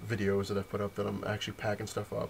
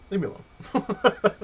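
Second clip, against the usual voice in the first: high, slow, louder mock whine; whiny protest; 11 semitones higher, 7 dB louder, much slower than usual. Whining at his fiancée to leave him alone, high and slow.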